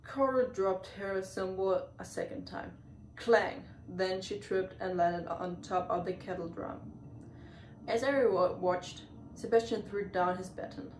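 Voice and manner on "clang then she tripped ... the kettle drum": ordinary delivery, nothing marked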